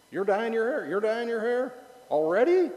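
high, surprised questioning; mock surprise. Real, not acted. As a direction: act amazed at the news. Surprised at congregant's hair.

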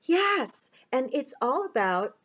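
plain reading voice

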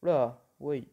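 un oula lâché fort, pris de court; réagis d'un coup, surpris. Real, not acted. Réaction soudaine en vol. (surprise soudaine et forte)

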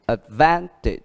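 ad-VAN-tage with the weight on van, falling; teacher model, stress on van. Shown as a demonstration. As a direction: lean on van in advantage. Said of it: "nhấn trước ở chữ van".

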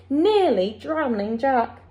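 plain reading voice

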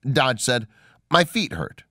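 ordinary delivery

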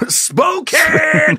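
high, shouted announcer flourish; hammed-up announcer. Host finishes co-host's location announcement, hammed-up announcer. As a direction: belt out the city like a ring announcer. Mock announcer names the city.

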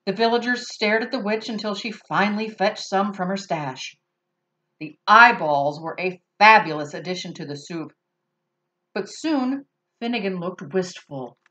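usual speaking voice

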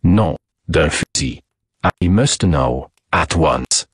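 put-on low, slow French-accented demand; insistent French accent. Detective must have the answer, insistent French accent.

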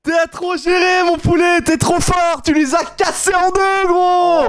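high, elated yelling; en train de gueuler. Real, not acted. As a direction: scream praise at your winning friend. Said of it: "je suis en train de gueuler". (crier sa joie dans l'aigu)

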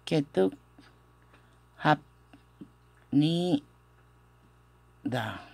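isolated syllables with long pauses; syllable-by-syllable demo. Shown as a demonstration. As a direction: sound out a difficult word, one piece at a time. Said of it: "Es media difícil esta pronunciación". (sílaba por sílaba, con pausas largas)

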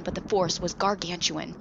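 plain reading voice

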